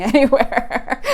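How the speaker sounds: laughing through the words. Real, not acted. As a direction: giggle while pointing anywhere. Laughing while pointing.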